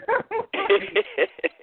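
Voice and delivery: loud, high cackle of laughter; high loud burst of laughter. Real, not acted. Laughs at own joke.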